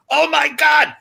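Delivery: mock panicked passengers; shocked cry. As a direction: blurt it out in sudden panic.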